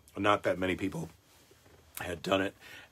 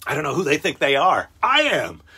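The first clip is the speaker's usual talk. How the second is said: louder retort, rising higher on I am; loud mock yell; 6 semitones higher, 9 dB louder than usual. Yelling at the vaccine text alerts, mock yell. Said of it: "where I can yell that at someone".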